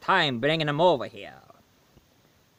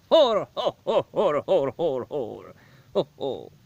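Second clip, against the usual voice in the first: put-on jolly Santa ho-ho in a Swedish accent; slower than usual. Santa greets the reviewer, jolly Swedish accent. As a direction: Santa booming with a Swedish lilt. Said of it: "what's with the Swedish accent?".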